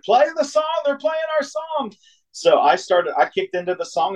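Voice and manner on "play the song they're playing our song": put-on high, thrilled drunken cry of joy; excited high squeal. Drunk fan yelling to his friends about the song, high excited squeal. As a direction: squeal it like your favourite song just came on.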